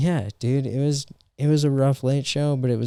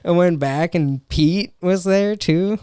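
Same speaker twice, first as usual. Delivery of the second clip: normal talking voice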